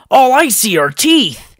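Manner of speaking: high, loud disbelief; appalled at the sight; incredulous. A narrator's reading. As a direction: Oniwa gaping at the spiders. Oniwa appalled.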